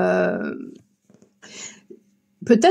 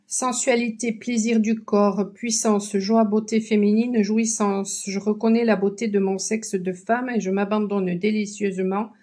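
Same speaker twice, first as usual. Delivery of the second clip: affirmation reading; 5 dB quieter than usual. Acted. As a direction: savour each word gently. Reads the card's affirmation.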